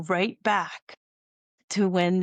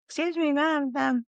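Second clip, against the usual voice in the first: retold attempt to catch someone's ear; calling for attention; 8 semitones higher than usual. Checkpoint worker to passenger dumping ice, calling for attention. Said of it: "I was trying to get her attention".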